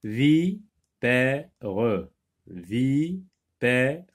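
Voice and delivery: "Vipère" slowly as vi... pè... re; drawn-out "vi", pause, "pè", "re"; syllable by syllable. Shown as a demonstration. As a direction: sound out "Vipère" in three parts, rising on vi. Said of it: "dans la deuxième syllabe".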